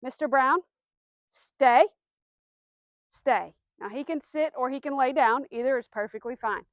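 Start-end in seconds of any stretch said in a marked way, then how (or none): 0.0-3.5 firm, louder stay command. Shown as a demonstration. Trainer showing her dog the stay command, firm and louder. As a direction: plant the command firmly. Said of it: "Wanna say it in a pretty firm tone".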